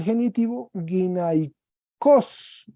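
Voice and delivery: guinaicos said slowly, syllable by syllable; slow dictation. Shown as a demonstration. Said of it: "Mire cómo se expandió". (guinaicos, lento y claro)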